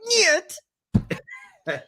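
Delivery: very high, drawn-out mock-Russian refusal; mock Russian accent. Boyfriend told to do this, refusing in mock Russian accent. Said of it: "very below average Russian accent".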